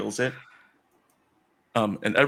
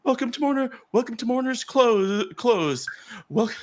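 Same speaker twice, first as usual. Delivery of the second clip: high, eager greeter voice; high-pitched ceremonial welcome; welcoming townsfolk; 12 semitones higher than usual. Acted. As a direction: greet visitors in a high, keen voice. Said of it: "and they're like".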